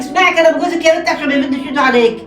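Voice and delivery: mother protesting loudly at hitting her daughter; loud alarmed protest. Acted. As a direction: cut him off in alarm then turn on your daughter. Mother reacts to hitting threat.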